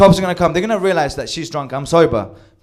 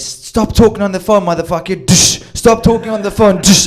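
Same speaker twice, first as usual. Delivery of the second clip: yelling; 5 semitones higher, 6 dB louder than usual. Retold or imitated. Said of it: "he can hear in the background".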